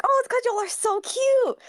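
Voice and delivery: high, sugary fake compliment; fake sweet excuse. Lying to the ex about the screenshot, sugary and high. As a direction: sell the lie sweetly up high.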